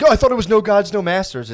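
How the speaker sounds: high, loud mocking whine; mocking commenters. Mocking internet commenters in a high whiny voice. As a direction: sneer it like a smug commenter. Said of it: "then they'll be like".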